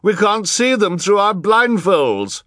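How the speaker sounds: high, loud protesting yell; shouted high, in loud complaint; Mister Lesko yelling. A narrator's reading. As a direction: yell it out to the whole crowd. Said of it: "yelled Mister Lesko".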